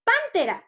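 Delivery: "Pántera" with a high, punched PAN that falls off; wrong stress on PAN. Shown as a demonstration. Teacher pretending to name the animal, stress on PAN in "Pántera". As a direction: jump up on the first syllable of "Pántera". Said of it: "así no se pronuncia".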